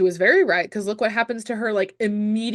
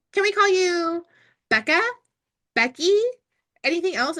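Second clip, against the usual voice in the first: higher-pitched imitation of tentative asking; mock friendly asking; 8 semitones higher than usual. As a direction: offer options lightly, lifting the pitch. Mimics friends asking to use nickname.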